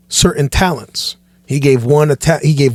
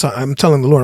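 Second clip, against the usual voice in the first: usual speaking voice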